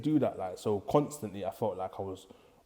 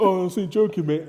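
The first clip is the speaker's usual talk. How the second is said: loud, high, laughing mock apology; 8 semitones higher, 10 dB louder than usual. Teacher taking back his insult, imitated loudly with a laugh. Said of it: "that's your banter".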